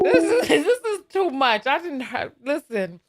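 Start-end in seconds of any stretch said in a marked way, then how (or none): 0.0-1.7 higher and louder, laughing through it; cracking up mid-sentence. Real, not acted. Host cracking up after the caller's story, laughing.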